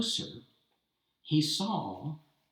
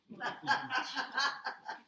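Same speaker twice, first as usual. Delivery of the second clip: mocking laugh; 13 semitones higher, 4 dB quieter than usual. Tsar mocks the painter.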